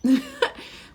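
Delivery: sharp high disbelieving laugh; a loud startled laugh of disbelief; incredulous burst of laughter. Real, not acted. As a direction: laugh out at how absurd it sounds. Reacting to absurd client remark.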